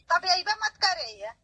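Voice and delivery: ordinary delivery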